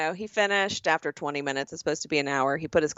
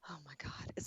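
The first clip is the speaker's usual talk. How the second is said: whispered oh my God; 5 semitones lower, 23 dB quieter, much faster than usual. Real, not acted. Friend reacting to gross bug story, whispered. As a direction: breathe it out in disgust. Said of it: "reacting to worm joke".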